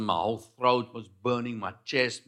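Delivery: normal talking voice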